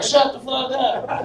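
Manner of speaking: high-pitched mock yell at the dog; retold yell. Pizza customer yelling at a barking dog, mock yell. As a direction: imitate an owner yelling, high and drawn out. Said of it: "they start yelling".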